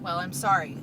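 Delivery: usual speaking voice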